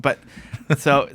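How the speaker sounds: normal talking voice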